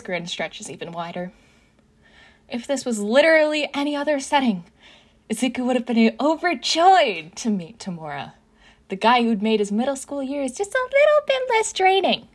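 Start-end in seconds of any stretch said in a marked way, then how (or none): 2.5-12.3 high, loud, overwrought narration; rising, frantic list of disasters; mounting panic. A narrator's reading. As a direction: narrate as if spiraling into hysteria. Narrator mirrors Izuku's mounting panic.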